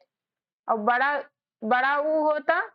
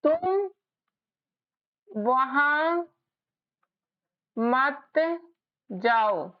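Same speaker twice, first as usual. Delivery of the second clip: तुम वहां मत जाओ spoken word by word with long pauses; spaced out like dictation for spelling; slow dictation; much slower than usual. Shown as a demonstration. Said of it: "जैसे मैं यहाँ पर लिख रही हूँ".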